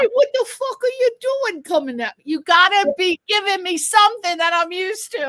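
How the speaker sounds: put-on Italian-American street accent, quick at first. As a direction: argue like an Italian-American New Yorker, playfully. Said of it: "especially the Italian accent".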